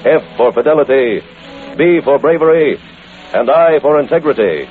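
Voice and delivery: measured announcer read of the motto. Acted. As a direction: spell it out like a formal announcer.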